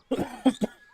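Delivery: dry cough into the mic; real cough. Real, not acted. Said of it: "sorry if I'm coughing into the mic".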